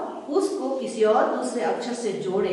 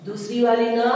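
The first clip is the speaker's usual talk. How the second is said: normal talking voice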